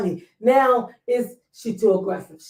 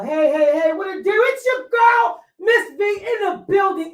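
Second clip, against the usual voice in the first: hyped show intro; 8 semitones higher, 4 dB louder than usual. Acted. Host hyping the show opening.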